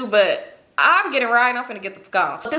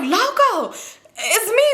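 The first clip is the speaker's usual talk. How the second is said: high, excited call to be recognised; eager greeting; 9 semitones higher, much slower than usual. Retold or imitated. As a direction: call out happily to get noticed. Retold eager greeting of Loco.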